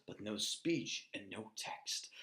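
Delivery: breathy whispered narration. A narrator's reading. Poet whispering the gesture to listeners. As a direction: drop to a whisper for the secret.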